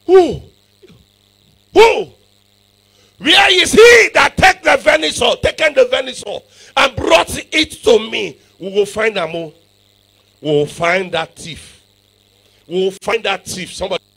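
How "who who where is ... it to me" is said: Isaac's demand shouted high from the pulpit; preaching at full force. Acted. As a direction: thunder the old father's question at the congregation.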